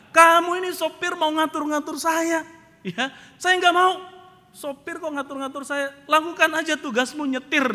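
loud, high, irate scolding; Pak Mukidi. Retold or imitated. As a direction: tell the driver off angrily. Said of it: "Pak Mukidi marah-marah". (memarahi dengan suara tinggi dan keras)

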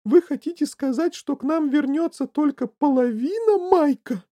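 high, loud horrified mother. A narrator's reading. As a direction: cry out aghast at the very idea. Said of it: "ужаснулась миссис Ти Ви".

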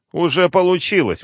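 high, loud smug reply; smirk. Acted. General gloats at the colonel, high and loud. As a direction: savor the win. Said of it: "ухмыльнулся Скороденко".

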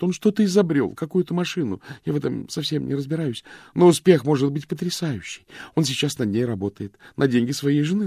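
high, loud anxious torrent; frightened mistress begging for her lover's sake; frantic. Acted. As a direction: pour it out in a panicked rush. Vivien frantically explains her fear.

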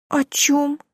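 put-on high, tearful girl's voice, pleading; a crying mermaid girl apologising; tearful young girl. Уля speaks. (плачущая девушка, высокий голос)

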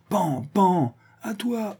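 high bang-bang and call; playful mock gunshots. A narrator's reading. Druid hands the next shot to Otto, playful mock gunshots. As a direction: toss out the bangs in a high voice.

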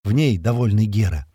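usual speaking voice

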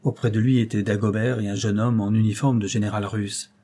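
ordinary delivery, nothing marked

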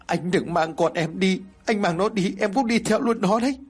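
wife begging her dead husband through tears; crying plea. Acted. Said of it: "Vợ của anh Bình cũng khóc rồi nói".

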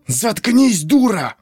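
hissed furious command; angry hiss. A narrator's reading. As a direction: hiss it angrily under breath. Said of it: "гневно зашипел Клем".

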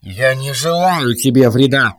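cold metallic robot voice. Acted. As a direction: speak like a machine through a helmet. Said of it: "сказал металлический голос робота".

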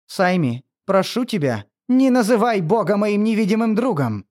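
building to a furious, high, loud shout; berserk rage. Acted. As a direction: scream it out in fury. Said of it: "В бешенстве закричал Томас".

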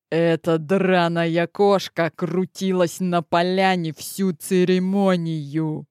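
low, lazy and gritted, words squeezed out; through gritted teeth. Acted. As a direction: snarl it through clenched teeth. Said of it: "процедил сквозь зубы Омерфор".

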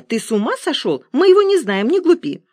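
high, loud indignant scolding. A narrator's reading. Woman scolds her old man about a stranger, indignant. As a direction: scold him, pitch shooting up. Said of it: "возмутилась женщина".

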